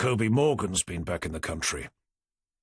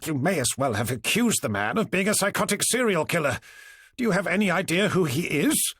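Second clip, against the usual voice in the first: scolding superintendent; 7 semitones higher than usual. A narrator's reading. Forrester scolding Tanner.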